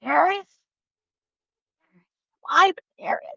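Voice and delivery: high, incredulous "why Paris?"; father's disbelief. Retold or imitated. Father hearing his child's plan, high and incredulous. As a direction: ask it high and baffled, like a doubting father. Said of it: "he was like".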